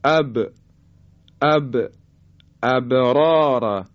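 اب with a short bounce after the ب; slow ابرار, the still ب released with an echo; qalqalah on ba. Shown as a demonstration. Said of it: "حرف با رو چون از حروف قطب و جده".

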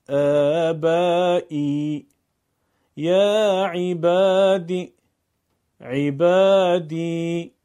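یاء مدی کشیده; drawn ya madi recitation. Shown as a demonstration. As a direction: recite each word stretching the long i.

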